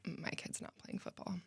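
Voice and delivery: very quiet, toneless deadpan quote. Retold or imitated. Coach quoting parents on their own kids, deadpan. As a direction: imitate a blunt refusal, almost under the breath. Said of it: "they look at me deadpan and say".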